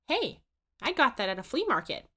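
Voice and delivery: object, a little offended; indignant Susan. A narrator's reading. Susan protests about her saucepan, high indignant. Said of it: "says Susan".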